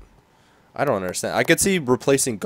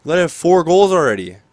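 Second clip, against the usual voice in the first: loud, raised-pitch exasperated outburst; angry; 5 semitones higher, 6 dB louder, slower than usual. Real, not acted. Player scolds his goalie for letting goals in, angry. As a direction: rail at the goalie, high and loud. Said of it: "I'm getting so angry right now".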